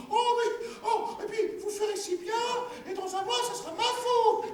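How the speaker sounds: high, plaintive woman's voice, half crying; wife's high whining lament. Acted. Wife protesting to her husband, high and tearful. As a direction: whine and protest like a fretful peasant wife.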